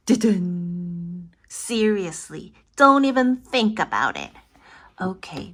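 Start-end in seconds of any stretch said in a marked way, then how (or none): 0.1-1.3 low mock-dramatic dun-dun. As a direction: hum a suspense cue. Dramatic sting after warning.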